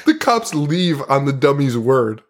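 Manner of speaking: ordinary delivery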